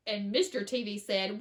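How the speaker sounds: ordinary delivery, nothing marked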